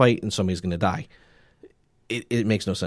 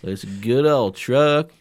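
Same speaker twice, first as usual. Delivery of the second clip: put-on Southern accent; 5 semitones higher, 7 dB louder, slower than usual. Redneck truck owner bit.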